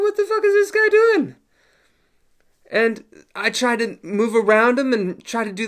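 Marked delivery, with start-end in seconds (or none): 0.0-1.4 high, loud, strained disbelief; baffled squeak. Retold or imitated. Baffled fighter squealing at his scooting opponent. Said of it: "I was like".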